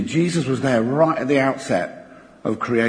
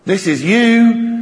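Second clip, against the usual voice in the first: slow, higher and louder gasp of awe; awed realisation; 5 semitones higher, 4 dB louder, slower than usual. Retold or imitated. Preacher voicing Isaiah seeing God, slow awed realisation. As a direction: let it dawn on you slowly, in wonder. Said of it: "He's seen something and thinking".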